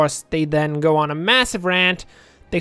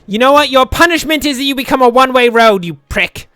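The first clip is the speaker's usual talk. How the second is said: loud, higher mock fury; mock-angry scolding; 6 semitones higher, 7 dB louder than usual. Builder punishing a stubborn road, mock-angry scolding. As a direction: punish the road with fake rage.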